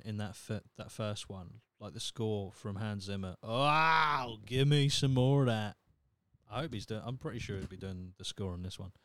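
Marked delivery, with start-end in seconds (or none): 3.4-5.8 loud, drawn-out appreciative wow; relishing wow. Real, not acted. Film fan raving about [name]'s score, loud relish. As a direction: savour it loudly, wanting more.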